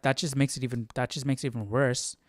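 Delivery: normal talking voice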